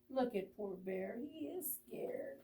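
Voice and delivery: soft, pitying aside; hushed pity. Real, not acted. Storyteller to children, soft pity.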